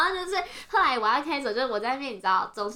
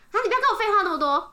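fast, high, louder mock scolding; retold impatient scolding; 9 semitones higher, 5 dB louder, faster than usual. Imitating the old man refusing explanations, fast and impatient. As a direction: cut her off quickly in imitation.

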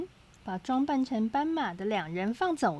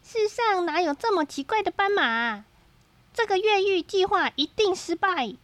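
higher amused character voice; Hutuchong voice; 6 semitones higher, 3 dB louder than usual. A narrator's reading. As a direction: voice the laughing prisoner. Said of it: "他对糟糕和不留神说".

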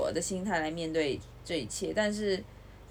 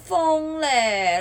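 exasperated laugh; much slower than usual. Real, not acted. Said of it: "真的快疯了".

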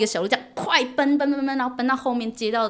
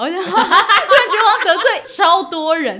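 laughing through the words, slow; 8 semitones higher, 9 dB louder, slower than usual. Real, not acted. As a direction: laugh as you admit it. Laughing at her own predicament.

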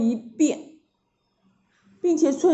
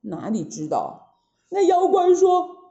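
plain reading voice

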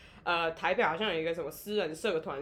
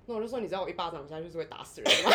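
usual speaking voice

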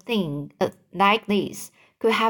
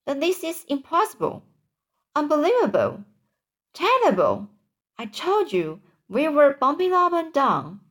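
higher-pitched exclamations of disbelief; mildly exasperated complaint; indignant disbelief; 7 semitones higher than usual. A narrator's reading. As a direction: protest in a higher voice. Passengers react to the disaster.

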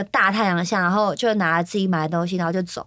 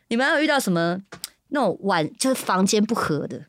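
ordinary delivery

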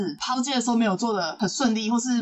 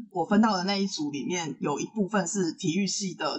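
normal talking voice

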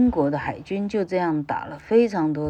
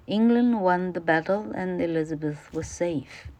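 careful, clear classroom reading; reading the passage aloud to students; textbook read-aloud; 3 semitones higher than usual. Acted. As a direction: read it out for the pupils. Teacher reads English textbook.

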